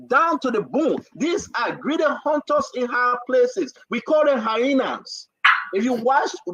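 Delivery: rising, preacher-style denunciation; impassioned preaching. Real, not acted. Host preaching to Liberians about greedy leaders. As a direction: preach it to the nation with fire.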